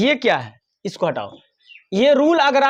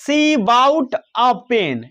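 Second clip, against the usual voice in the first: slow dictation; much slower than usual. Shown as a demonstration. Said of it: "teacher reads example slowly".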